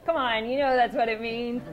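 laughing, daring the listener to disagree; laughing aside. Real, not acted. Laughing at hierarchy remark.

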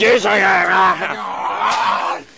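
high, drawn-out loud yell; insistent shout. Acted. Friend pushing Paul in, drawn-out high shout. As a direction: shout it as you shove.

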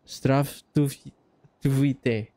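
"zdrastuvite" very slowly, piece by piece; sounding it out. Shown as a demonstration. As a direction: sound out "zdrastuvite" haltingly, drawn right out. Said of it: "How the hell did you say that?".

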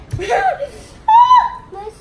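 shrieking giggle; loud laughing shriek. Real, not acted. Kid laughs loudly.

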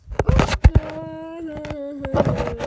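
big, loud laugh; a loud laugh in the middle of the squabble; loud burst of laughter. Acted.

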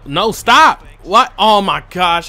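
high, loud, exasperated protest; frustrated yell. Real, not acted. Gamer yelling at his glitchy controller, frustrated shout. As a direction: yell at the controller as it betrays you. Game does wrong move.

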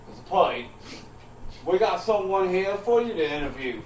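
ordinary talk

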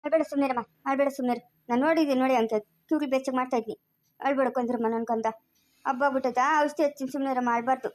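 low, soft comforting hush; gently rock the crying baby quiet with your voice; soothing a child. Acted.

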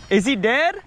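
ordinary delivery

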